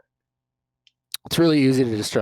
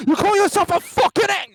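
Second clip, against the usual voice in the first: much higher, louder mock British chef shout; Gordon Ramsay impression; 7 dB louder than usual. Topping a friend's impression, loud mock chef shout. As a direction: scream it like a furious celebrity chef. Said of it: "I can't, I can't do a Gordon Ramsay".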